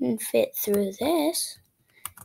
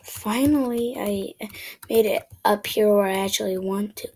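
ordinary talk

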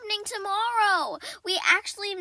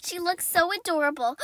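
ordinary talk, nothing marked

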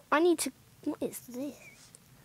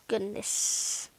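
unvoiced, softer sigh of dismay; breathed dismay; 3 semitones lower, 8 dB quieter than usual. Real, not acted. Reacting to money draining.

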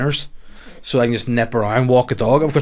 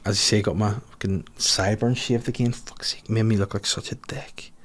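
unvoiced whisper, softer, grumbling; whispered aside; 2 semitones lower, 8 dB quieter than usual. Real, not acted. Comic confiding to listeners about a bad shave, whispered. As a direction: mutter it under your breath to yourself. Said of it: "sideburn aside".

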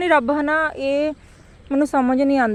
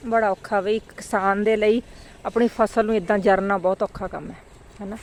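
ordinary talk

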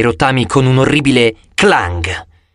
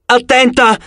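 yelling; 10 semitones higher than usual. A narrator's reading. Hero yells a warning as the giant stumbles into the power lines. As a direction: scream a warning.

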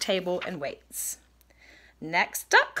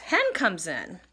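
ordinary delivery

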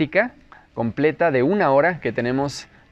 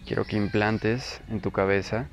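slow, softer, lower meditation voice with long pauses; guided meditation; 3 semitones lower, 11 dB quieter, slower than usual. Acted. Teacher leading listeners into breath awareness, guided meditation. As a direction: guide the listener unhurriedly through breath and body.

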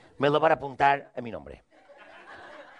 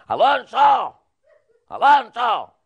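high, loud call up to the tree; 8 semitones higher, 8 dB louder, much slower than usual. Retold or imitated. Calling up to Alonso in his tree house, loud call. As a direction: shout his name upward.

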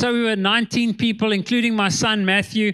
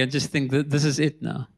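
fading to a hushed, softer voice; quiet dread; 7 semitones lower, 4 dB quieter than usual. Retold or imitated. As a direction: sink lower and quieter as the fear sets in. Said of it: "I get this cold feeling coming through my body".

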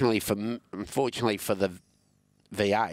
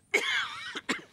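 sharp cough. Real, not acted.

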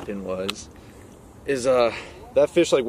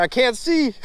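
high laughing complaint; laughing through the splash. Real, not acted. Water splashed in eye.

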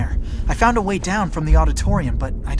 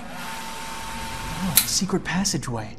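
hushed discovery; 9 dB quieter, much slower than usual. Acted. Spots a hidden passage, hushed.

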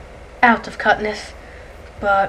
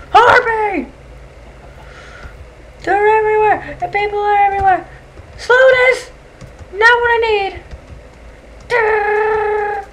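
high, loud frantic shouts; panicked yelling; 11 semitones higher, 4 dB louder than usual. Real, not acted. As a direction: yell in a frenzy. Panicked during monster attack.

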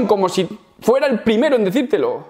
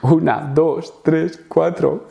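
slow counting; 3 semitones lower, much slower than usual. Shown as a demonstration. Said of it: "counting the ha-has slowly".

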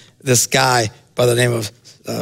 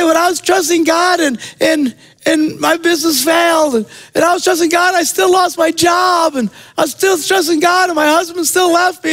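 high, strained complaining voice, pitched way up; whiny complaint; 14 semitones higher than usual. Retold or imitated. Believer complaining to the preacher in a high, pleading whine.

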